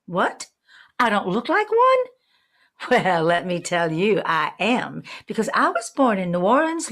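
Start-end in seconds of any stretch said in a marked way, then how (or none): 0.1-2.1 surprised. Pretend. Mock surprised question.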